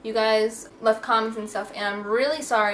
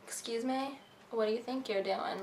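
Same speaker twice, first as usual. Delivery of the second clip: suspicious; 9 dB quieter than usual. Shown as a demonstration. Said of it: "the suspicious".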